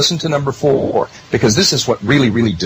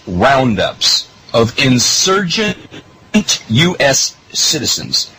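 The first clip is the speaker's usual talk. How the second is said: ordinary delivery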